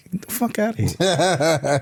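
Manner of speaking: slow, laughing brush-off; laughing at his ex-wife's threat; laughing disbelief. Retold or imitated. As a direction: laugh it off as nonsense. Retelling his reply to his ex-wife.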